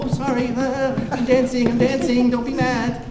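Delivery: timid Westerner dancing to bullets; meek apology. Retold or imitated. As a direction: cower and placate. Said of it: "we just go".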